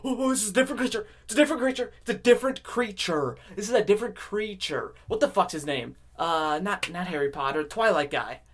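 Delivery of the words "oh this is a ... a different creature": high-pitched inner alarm, repeating itself; raised-pitch mock panic; alarmed subconscious. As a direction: play the subconscious sounding the alert. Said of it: "your subconscious, like".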